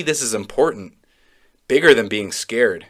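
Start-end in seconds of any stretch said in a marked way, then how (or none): none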